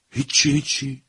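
put-on young man can't believe Fred's answer, incredulous; incredulous echo. A narrator's reading. Young man echoing Fred in disbelief, incredulous and high. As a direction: pitch jumps way up in disbelief on هیچی، هیچی. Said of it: "ابروهای مرد جوان به حالت ناباوری".

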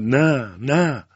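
high, loud repeated refusal; angry shout. A narrator's reading. Tishin angrily denying the commissioner's suspicion, shouting. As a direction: cry out in angry protest. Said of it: "بازم فریاد زد".

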